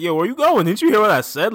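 loud, high acted protest; indignant calling after. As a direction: call after someone walking off. Acting Chi-Chi calling after Daisy.

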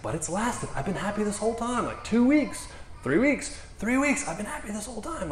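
high-pitched, marvelling retelling; acted excited report of good news; happily amazed woman.

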